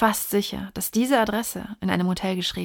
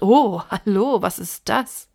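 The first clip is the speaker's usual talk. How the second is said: louder, startled "oh, hello"; surprised discovery; 6 dB louder, slower than usual. Acted. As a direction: react to an unexpected find. Reader voices sudden discovery.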